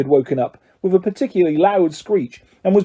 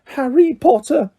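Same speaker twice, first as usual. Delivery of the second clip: high, slow, squeaky elf voice; high-pitched house elf; 9 semitones higher than usual. A narrator's reading. House elf greeting his hero, high and slow. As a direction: pitch it up like an eager little elf. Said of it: "in a high-pitched voice".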